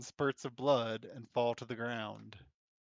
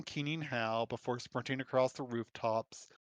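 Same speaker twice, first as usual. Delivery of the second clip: normal talking voice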